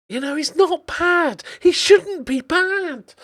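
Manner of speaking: very high, drawn-out complaint; plaintive protest. Real, not acted. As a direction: protest in a squeaky high pitch. Protesting that the player disappoints.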